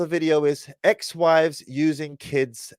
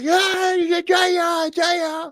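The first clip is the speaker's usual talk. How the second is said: put-on baby calling out; much slower than usual. Retelling how the baby ran to greet her sister, high baby squeal. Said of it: "She's like".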